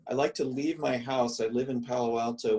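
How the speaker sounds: ordinary talk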